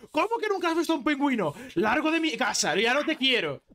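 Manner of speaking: high-pitched mock outrage; irate wife, acted for a joke; furious wife. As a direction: throw your husband out, indignant and high. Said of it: "le mira súper cabreada y le dice".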